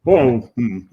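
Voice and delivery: mumbling through a mouthful of peanut butter; mouth full of peanut butter. Real, not acted. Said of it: "hard to talk with peanut butter in your mouth".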